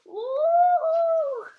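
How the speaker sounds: high, loud yelp of surprise; surprised ooh. Real, not acted. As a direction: let out a sudden squeal. Relief as the sock comes off.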